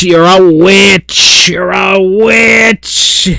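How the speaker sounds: slow, drawn-out hammed-up accusation; mock accusing shout. Streamer accusing a chatter of witchcraft, slow mock shout. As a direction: cry witch like a mad inquisitor.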